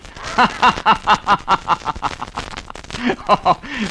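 big ho-ho group laugh; hearty laugh on cue. Acted. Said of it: "Let's all laugh together".

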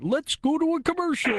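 very high, held-out clown voice; a kids' TV clown squealing the line; Bozo the Clown. Retold or imitated. Bozo cutting away after a kid swears, high clown voice. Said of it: "I love your Bozo voice".

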